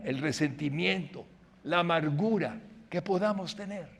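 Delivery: ordinary talk, nothing marked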